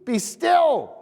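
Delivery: high, loud command to the storm; commanding rebuke. Retold or imitated. Jesus rebuking the storm, a loud high command. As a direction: order the storm to be still. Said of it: "he says".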